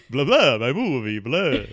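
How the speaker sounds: a silly count's "blah, blah"; playful mock vampire; Count Chocula. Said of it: "I thought I was talking like Count Chocula".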